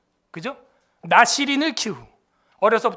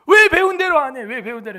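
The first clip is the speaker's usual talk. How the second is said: higher, louder scolding that drops off; imitating a scolding coach; 4 semitones higher, 6 dB louder than usual. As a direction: demand why they didn't do as taught. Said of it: "이 감독이 어떻게 하는지 제가 보여줬어요".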